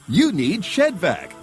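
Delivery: ordinary talk, nothing marked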